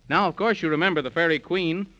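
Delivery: ordinary talk, nothing marked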